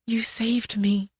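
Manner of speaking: slow soft whisper; barely voiced, slowed right down. A narrator's reading. Words repeated, first whispered.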